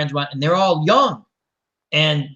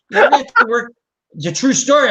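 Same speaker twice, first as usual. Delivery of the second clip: excited insistence; 9 semitones higher, 7 dB louder than usual. Real, not acted. Laughing insistence on his story.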